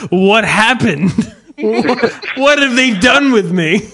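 high, slow, dazed waking voice; hammed-up just woke up. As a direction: play it confused, just startled awake. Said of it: "That was good".

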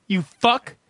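ordinary talk, nothing marked

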